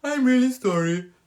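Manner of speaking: high, loud put-on Punjabi accent. Mock newsreader announcing the Queen's death, hammed-up Punjabi accent. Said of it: "That's a bit Punjabi".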